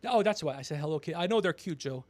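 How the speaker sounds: usual speaking voice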